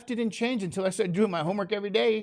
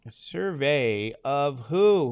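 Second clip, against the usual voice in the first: slow quiz prompt; much slower than usual. Shown as a demonstration.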